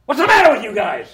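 big, high-pitched shout; John Belushi. Retold or imitated. John Belushi walks in on the guys, loud bellow. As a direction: burst in hollering at the whole room. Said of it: "he goes like this".

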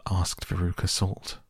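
ordinary delivery

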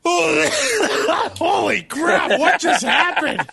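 sudden high raspy sick-voice yelp; smoker's rasp. Real, not acted. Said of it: "I sound like I've been smoking three packs a day".

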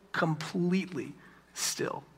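"completely" slow and soft, pause, then hushed "still"; "completely still" slowly, softly, word by word; COMPLETELY STILL set apart. Real, not acted. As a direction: drop to a hush on "still" after a pause. Contrast with a little bit rocky.

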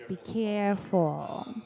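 normal talking voice

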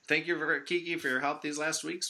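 ordinary talk, nothing marked

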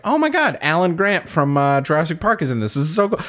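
high, thrilled fangirl squeal; remembered geeking out over the actors; excited gush. Retold or imitated. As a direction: gush over the cast in delight. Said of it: "I was like".

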